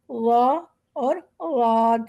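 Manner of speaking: slow وا اور واد, each drawn out; slow, careful وا اور واد; four different sounds. Shown as a demonstration. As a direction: say وا and واد slowly to set them apart. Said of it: "یہ ہماری چار مختلف آوازیں ہیں".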